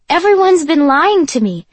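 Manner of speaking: put-on a child's hurt complaint; childlike high voice. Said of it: "my voice rising in pitch like a child".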